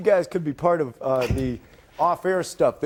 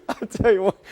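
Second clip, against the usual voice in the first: giggling through the words; trying not to laugh on air; anchor stifling giggles; 5 semitones higher, 3 dB louder than usual. Real, not acted.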